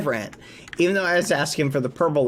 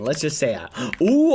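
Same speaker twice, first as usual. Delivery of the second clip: normal talking voice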